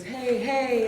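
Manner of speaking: loud, high "hey, hey"; coach's sharp call across the pool. Retold or imitated. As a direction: shout it to someone on the far side. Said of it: "across the pool".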